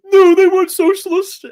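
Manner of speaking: very high, loud mock protest; YouTube objectors. Mimicking the YouTube armada on socialism, shrieky mock protest. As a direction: wail it like an outraged online objector. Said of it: "YouTube armada who are like".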